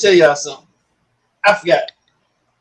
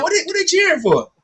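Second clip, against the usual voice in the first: much higher puzzled question; mock confusion; 11 semitones higher than usual. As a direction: act baffled at the cheering. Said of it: "I was like".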